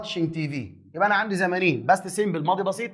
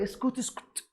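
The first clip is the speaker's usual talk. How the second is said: high, much softer shushing; mock hushed telling-off; hushing someone; 6 semitones higher, 11 dB quieter than usual. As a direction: cut the student off under your breath. Cuts off the imagined student.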